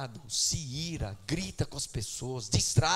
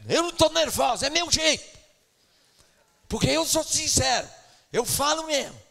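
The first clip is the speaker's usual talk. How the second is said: mock indignant outburst; 11 semitones higher, 8 dB louder than usual. Mocking a quarrelsome sister.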